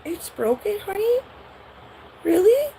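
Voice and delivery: high, sing-song parent voice; mock parent. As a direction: play the startled, doting parent. Parent answers the child.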